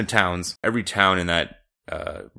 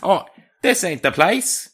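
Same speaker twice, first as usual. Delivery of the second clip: high, drawn-out Cockney impression; mock Cockney passenger; 10 semitones higher, 5 dB louder than usual. Co-host topping the passenger line, hammed-up Cockney. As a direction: echo the line in exaggerated Cockney, loud. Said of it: "the passenger reportedly said".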